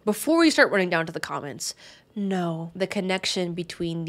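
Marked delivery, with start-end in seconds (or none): none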